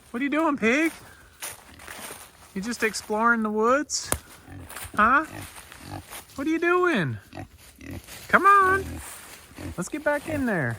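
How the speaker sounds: very high, sing-song coaxing voice; pet talk to a pig. Real, not acted. Farmer coaxing his escaped boar back, high-pitched pet talk.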